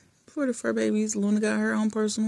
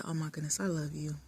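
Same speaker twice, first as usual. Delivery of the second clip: tender; 3 semitones lower, 10 dB quieter than usual. Retold or imitated. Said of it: "I'm just like".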